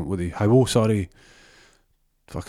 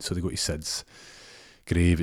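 normal talking voice

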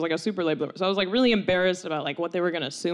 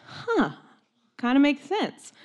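high, musing 'huh' of realization; mild realization; 6 semitones higher, slower than usual. Retold or imitated. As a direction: let it dawn on you, pitched up. Friend's reaction, acted.

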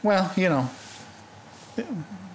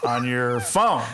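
slow, halting deadpan with stretched key words; Norm Macdonald; slower than usual. Acted. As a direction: do the breaks and spiked intonation. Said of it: "you do a good Norm Macdonald".

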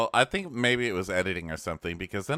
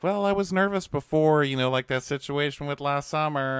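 nervous mom; 6 semitones higher than usual. Retold or imitated. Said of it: "the mom's like".

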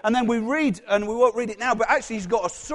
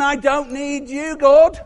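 slow, higher, jumping loud on God; defiant dismissal; 4 semitones higher, 8 dB louder, slower than usual. Acted. Prayerless Peter telling God he is unneeded, defiant. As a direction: declare independence, climbing to the last word. Voices the attitude of not praying.

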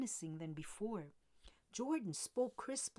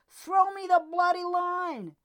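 loud, higher yelled order; howling order; 9 semitones higher, 14 dB louder than usual. A narrator's reading. Jordan demanding the tow line in the storm, yelling. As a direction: yell it over the storm. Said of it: "Jordan howled".

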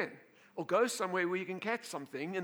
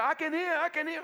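excited healed man; 12 semitones higher, 9 dB louder than usual. Retold or imitated.

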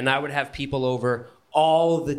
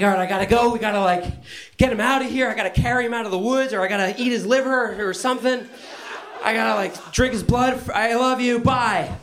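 high, fast, hyped rush; high giddy rambling with laughs; excited; 11 semitones higher, 3 dB louder, faster than usual. Retold or imitated. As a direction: wrap up the call in a frenzy. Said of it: "I was like".

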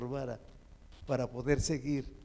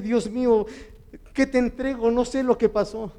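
high, loud appeal to God; anguished retold prayer; 6 semitones higher, 4 dB louder than usual. Preacher reliving his prayer on his knees, anguished. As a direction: plead aloud, reliving confusion.

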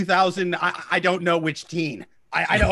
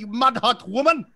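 put-on Scottish accent; 3 semitones higher than usual. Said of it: "in Scottish accent".

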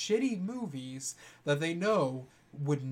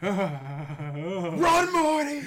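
high shout of Run, Morty; 3 semitones higher, 6 dB louder, much slower than usual. Retold or imitated. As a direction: yell it in panic. Shouted cartoon quote.

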